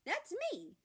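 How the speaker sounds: bright high "that's me"; excited. Real, not acted. Author proudly owning her own story. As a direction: chirp it out, pleased with yourself.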